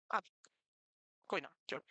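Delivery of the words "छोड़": usual speaking voice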